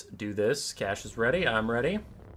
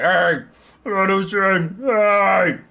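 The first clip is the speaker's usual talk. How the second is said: loud, high monster yell; yelling at the door; 7 semitones higher, 9 dB louder than usual. Acted. Monster hitting on the tower door, loud yelling. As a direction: holler while pounding to get in.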